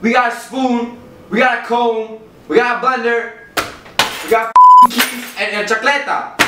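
high, loud strict father; angry dad rant. Acted. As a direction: rant at the son, heated. Angry immigrant dad ranting.

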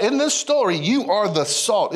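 ordinary talk, nothing marked

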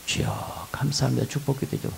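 hushed, breathy little prayer voice; mock pious whisper. Church member whispering a mock prayer for blessing. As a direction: whisper it like a fake-pious believer.

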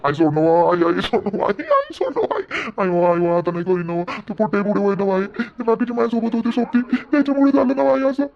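whimpering plea to let go; pleading son. Acted. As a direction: beg your mother to stop hitting. Son begging mother to stop.

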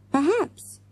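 high, rising, tentative; pondering aloud; thoughtful. A narrator's reading. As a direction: float the word as a new idea. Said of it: "said Morgan thoughtfully".

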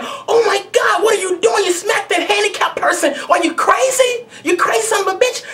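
high shouting bystander, appalled; outraged bystander. Retold or imitated. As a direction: play a horrified onlooker yelling. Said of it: "they gonna look at me as the bad person saying".

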